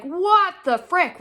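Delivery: high, loud and slowed right down; shocked what the frick. Retold or imitated. Shocked owner reacting to her sick dog, loud and slow. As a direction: spit it out in disbelief.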